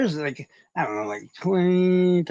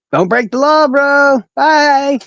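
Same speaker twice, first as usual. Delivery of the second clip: mock taunt; 11 semitones higher, 10 dB louder, slower than usual. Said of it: "And I'm just like".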